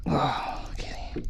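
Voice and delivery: breathy whisper at a fish in the water. Real, not acted. Angler peering down at his catch beside the kayak, whispering. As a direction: whisper in awe, barely a breath.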